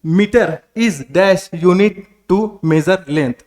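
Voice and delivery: slow reading aloud. Shown as a demonstration. Teacher reads the quiz question to students very slowly. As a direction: read the question very slowly, word by word. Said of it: "इसमें दिया जा रहा है कि".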